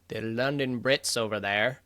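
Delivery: bad British accent. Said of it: "that was a terrible, terrible accent".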